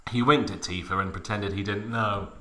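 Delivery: usual speaking voice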